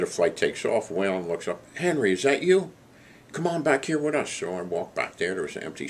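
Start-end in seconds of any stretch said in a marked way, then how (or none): 1.8-4.3 high, friendly call across the cabin. Retold or imitated. Waylon calling Henry back to his seat, high friendly call. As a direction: call him over from a few rows back, pitched up.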